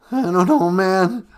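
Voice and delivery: Cowardly Lion. Acted. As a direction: fret like a scared lion. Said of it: "he sounds totally different today".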